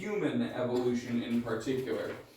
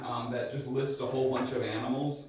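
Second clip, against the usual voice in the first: ordinary talk, nothing marked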